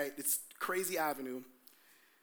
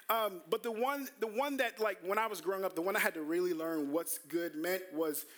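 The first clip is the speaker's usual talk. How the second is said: ordinary delivery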